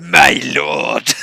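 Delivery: loud, oily evil vizier; fawning cry of a scheming vizier. Acted. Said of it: "it's time for evil Vizier voice".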